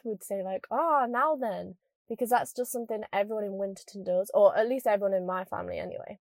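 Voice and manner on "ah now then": drawn-out northern English "now then", falling at the end; northern phone greeting. Shown as a demonstration. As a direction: greet granddad slowly in a northern English accent. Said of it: "whenever I'd pick up the phone to my granddad".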